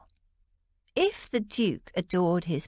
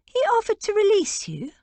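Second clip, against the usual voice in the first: much higher and louder, fast and incredulous; shocked disbelief; 14 semitones higher, 4 dB louder, faster than usual. A narrator's reading. Sister stunned by George's news, shocked and incredulous. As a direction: blurt it out in shock. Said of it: "a moment's shocked silence".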